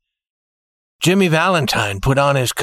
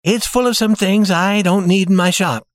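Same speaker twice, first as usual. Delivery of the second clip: slightly raised character voice; lightly voiced fiancé; 6 semitones higher than usual. A narrator's reading. Man explaining his bag to fiancée, lightly voiced. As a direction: lift the pitch a little for the man's reply. Jimmy answers Annabelle in story.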